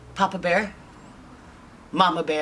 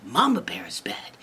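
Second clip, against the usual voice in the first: usual speaking voice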